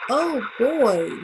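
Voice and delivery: put-on loud, bursting oh boy; excited exclaim. A narrator's reading. Reader spotting the lost glasses for the kids, loud and excited. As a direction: burst out with delight.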